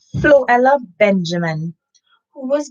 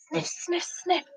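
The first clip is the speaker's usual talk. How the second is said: quiet sniffing noises; 7 semitones higher, 12 dB quieter than usual. Acted. Reader sniffing like Detective Dog Nell.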